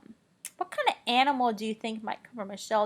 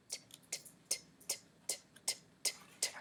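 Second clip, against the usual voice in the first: breathless repeated whispered t-; whispered sounding out; 15 dB quieter, much faster than usual. Pretend. Teacher whispers t- sounds to build suspense. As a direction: tease the answer letter by letter.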